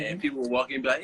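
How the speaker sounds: ordinary delivery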